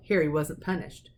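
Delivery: ordinary delivery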